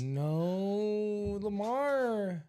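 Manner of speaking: long, high drawn-out wail of dismay; mock lament. Fan bemoaning Lamar's interception, mock wailing. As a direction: groan it out high and stretched, dismayed.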